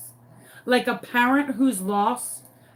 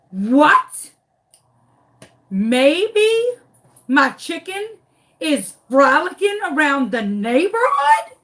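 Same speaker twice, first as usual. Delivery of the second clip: outraged disbelief, rising at every phrase; incredulous echo; 4 semitones higher, 5 dB louder, slower than usual. Retold or imitated. Storyteller echoing the neighbor's chicken theory, incredulous. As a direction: throw it back as an absurd question.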